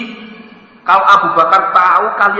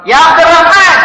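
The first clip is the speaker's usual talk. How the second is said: much louder and higher shouted call; calling out angrily; 13 semitones higher, 9 dB louder than usual. Acted. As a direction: bellow the name across the house. Abu Bakar memanggil lagi. (Abu Bakar berteriak memanggil Abdurrahman)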